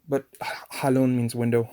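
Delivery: ordinary talk, nothing marked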